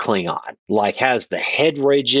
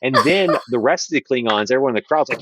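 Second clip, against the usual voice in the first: normal talking voice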